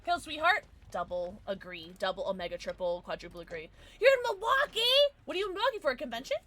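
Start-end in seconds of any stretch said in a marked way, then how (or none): 4.0-6.4 high-pitched, incredulous question; surprised. Real, not acted. Streamer learning a friend is in Milwaukee, high surprised voice. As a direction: ask in squeaky disbelief.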